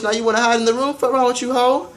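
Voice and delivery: high taunting mock. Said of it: "And I was like".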